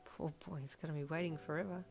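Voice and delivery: quick, hushed fatherly aside; muttered under breath. A narrator's reading. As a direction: mutter it softly to yourself. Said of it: "Kiri muttered under his breath".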